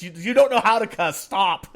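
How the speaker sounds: loud mock scolding kid; quoting the kid. Kid in the movie scolding someone who can't cuss, loud. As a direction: bark it like a bossy kid. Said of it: "It's like".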